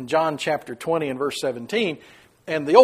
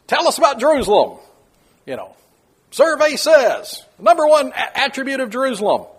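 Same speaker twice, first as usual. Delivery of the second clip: high, loud survey announcer; game-show host; 12 semitones higher, 6 dB louder than usual. Acted. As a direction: call it out like a quiz host. Mock game-show survey question.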